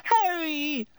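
usual speaking voice

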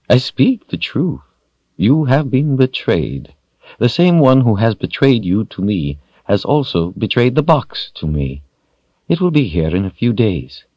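lightly voiced villain, lifted pitch; a touch of theatrical menace; silky villain. A narrator's reading. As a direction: savour the betrayal a little. Villain's dialogue in narrated story.

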